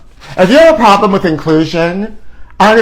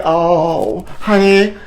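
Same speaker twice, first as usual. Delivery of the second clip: normal talking voice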